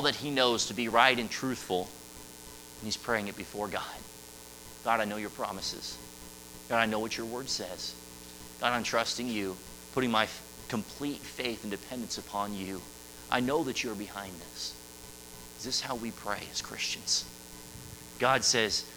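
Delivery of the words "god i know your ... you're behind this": soft, subdued, earnest prayer; quiet trusting prayer. Acted. Jonah praying it before God, quiet trusting prayer.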